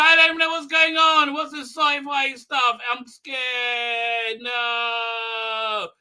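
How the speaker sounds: high, wailing cry of fear; hammed-up scared whining, trailing into a long wail; mock panic. Acted panicking character, says scared.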